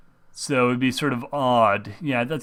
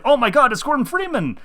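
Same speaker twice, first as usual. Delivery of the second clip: much higher, louder awed gasp of a name; excited rebels; 12 semitones higher, 4 dB louder than usual. Retold or imitated. Rebels greeting Gordon Freeman on his return, awed and excited. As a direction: gush it like a starstruck fan. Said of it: "all the rebels are like".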